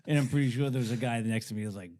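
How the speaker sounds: usual speaking voice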